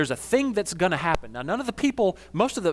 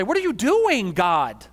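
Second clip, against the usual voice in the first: much higher and louder, an exasperated outburst; frustrated; 5 semitones higher, 5 dB louder, slower than usual. Shown as a demonstration. Pastor venting at God, loud frustrated demand. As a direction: fire the question at God in frustration. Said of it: "out of frustration".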